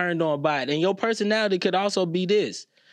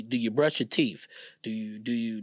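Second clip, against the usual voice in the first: ordinary talk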